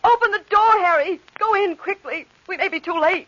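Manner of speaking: high, loud, urgent commands; urgent plea. Acted. Woman urging Harry to open the door, urgent and high. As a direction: push him to hurry.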